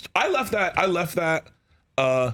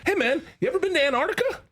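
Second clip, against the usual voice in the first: high, smooth leading question; breezy, high-pitched feigned curiosity; fake casual; 13 semitones higher, faster than usual. Shown as a demonstration. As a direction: coach a setup, playing it innocent. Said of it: "just totally smoothly".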